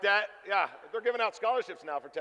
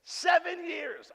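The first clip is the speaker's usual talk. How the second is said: higher, slowed stunned shout; 9 semitones higher, much slower than usual. Real, not acted. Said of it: "stunned echo".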